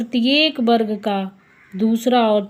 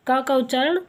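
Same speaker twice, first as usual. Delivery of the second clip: ordinary talk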